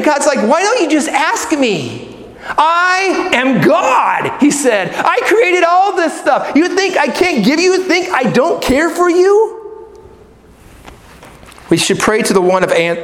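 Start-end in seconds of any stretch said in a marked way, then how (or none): 0.5-4.4 emphatic, booming indignation with stretched words; exasperated God. Acted. 4.9-9.9 high-pitched, stretched-out incredulity; indignant God. Acted. God acted as indignant at doubters.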